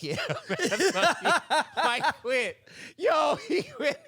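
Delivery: high, loud laughing speech; cracking up. Real, not acted. Teller recalls teammate giving up, cracking up. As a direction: laugh helplessly through the words.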